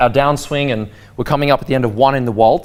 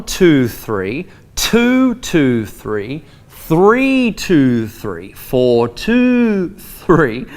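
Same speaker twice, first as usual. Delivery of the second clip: phrased counting; much slower than usual. Shown as a demonstration. Teacher showing phrased waltz counting to students. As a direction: lift each bar number, let two three fall. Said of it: "That's phrased".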